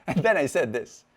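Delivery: normal talking voice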